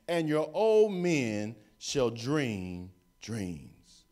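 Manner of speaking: loud, slow preaching of the verse; preaching scripture. Real, not acted. Preacher reading Acts aloud to the church, booming and slow. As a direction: proclaim it, stretching the last line.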